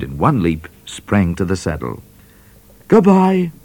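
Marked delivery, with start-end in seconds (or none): 2.9-3.5 much higher, loud goodbye; cried out. A narrator's reading. Midnight calling goodbye to the trooper, loud and high. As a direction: fling it out. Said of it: "he cried".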